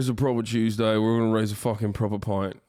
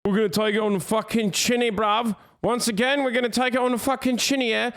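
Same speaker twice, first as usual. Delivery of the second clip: much higher, fast mock Boris voice; Boris Johnson impression; 10 semitones higher, faster than usual. Streamer mocking Boris Johnson to chat, high and fast. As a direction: ham up a posh British PM, fast and high. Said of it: "Boris Johnson just recently came out and said".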